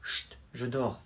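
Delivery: dad pretending to sleep, soft and slow; sleepy hush. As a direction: mumble half asleep. Dad half asleep.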